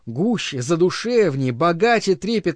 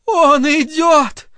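much higher, slow rapturous shout; 10 semitones higher, 5 dB louder, much slower than usual. Acted. Sarah Jinny shouts to the dancers, rapturous. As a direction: call out the arrival. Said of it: "крикнула Сара Джинни".

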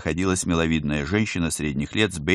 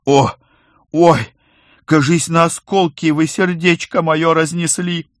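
hammed-up clutching the chest; loud, slow fake heart pain; mock heart attack; 11 semitones higher, 8 dB louder, slower than usual. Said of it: "схватился за грудь".

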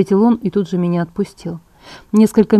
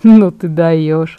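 very slow, louder, drawn-out amazement; admiring; 2 semitones lower, 6 dB louder, much slower than usual. A narrator's reading. Emmett marvels at Bella's scheme, admiring. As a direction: savour each word, impressed. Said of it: "восхищённо присвистнул Эммет".

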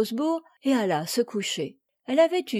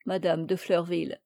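ordinary delivery, nothing marked

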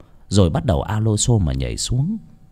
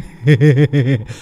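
higher, menacing staccato laugh; villain's cackle; 4 semitones higher than usual. A narrator's reading. Chú Hùng laughing while hunting the boy, menacing cackle. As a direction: cackle like a crazed pursuer.

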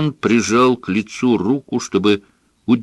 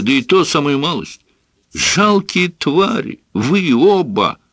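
furious outburst; 8 semitones higher than usual. A narrator's reading. Father rages at his son and mother. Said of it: "воскликнул Бирн".

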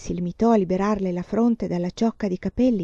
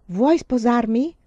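eager repeat proposal; 5 semitones higher, 6 dB louder, slower than usual. A narrator's reading. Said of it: "chiese di nuovo".

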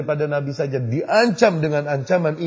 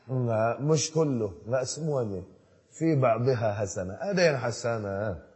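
mock objection; 5 semitones lower, 5 dB quieter than usual. Mocking the bid'ah defenders again.

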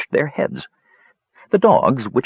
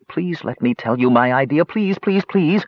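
begging, climbing high on each please; pleading; 6 semitones higher than usual. Acted. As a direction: plead like an eager child, rising in pitch. Talarin begs to tell his idea.

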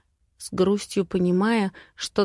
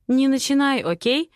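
higher, firm warning; toughened tone; 6 semitones higher than usual. A narrator's reading. Heroine cuts off her friend's prying about boyfriend, hardened tone. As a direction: shut the topic down sharply. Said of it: "Ужесточаю тон".

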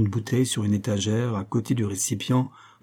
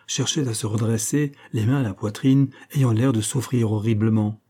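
usual speaking voice

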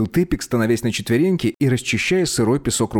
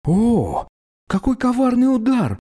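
high, theatrical complaint to heaven; complaining; 12 semitones higher, slower than usual. A narrator's reading. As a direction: wail it up to the sky. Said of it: "пожаловался небу Тепик".

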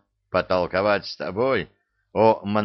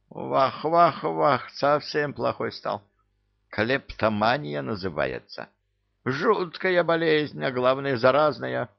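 high, wailing vah vah; lamenting eastern trader; 6 semitones higher than usual. Acted. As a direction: wail like a sly trader. Thief character lament.

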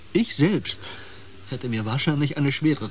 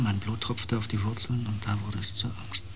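fearful whisper; 4 semitones lower, 7 dB quieter than usual. Acted. Osden tells the crew how the fear began, whispering. Recounting bleeding in the forest, whispering.

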